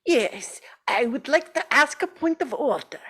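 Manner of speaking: lightly put-on Russian accent; a deliberately bad Russian accent, only hinted at. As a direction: councillor interjecting in a faint mock Russian accent. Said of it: "bad Russian accents".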